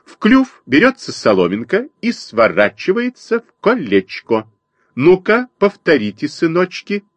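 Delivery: high chirpy teacher bird; sparrow mother teaching. A narrator's reading. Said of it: "защебетала воробьиха".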